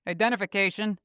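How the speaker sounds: firm security system voice; demanding gate voice. A narrator's reading. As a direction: command it like a guard machine. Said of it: "a voice demands".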